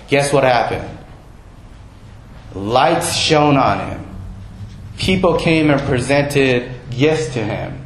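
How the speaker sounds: ordinary talk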